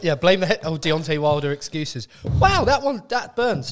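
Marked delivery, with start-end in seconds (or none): 2.2-3.7 surprised. Real, not acted. Reacting to spicy sauce.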